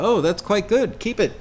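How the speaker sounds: high, airy British producer voice, breaking into a laugh; offhand British approval with a chuckle; casual British accent, laughing; mock British accent.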